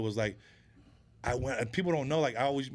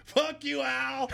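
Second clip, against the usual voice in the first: mock yell; 14 semitones higher, much slower than usual. Brother confronts football player.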